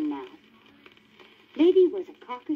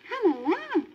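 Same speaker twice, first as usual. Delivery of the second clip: put-on higher, urgent young dog voice; Lady crying out; 12 semitones higher than usual. A narrator's reading. Lady cries out to the Tramp, high and urgent. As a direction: call it out pitched up as Lady. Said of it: "cried Lady".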